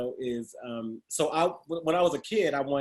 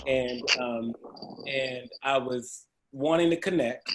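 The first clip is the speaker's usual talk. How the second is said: usual speaking voice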